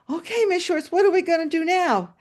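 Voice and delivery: a hint of an eager kid; boy's voice. Boy asking his teacher what to do next, lightly voiced kid. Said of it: "He goes".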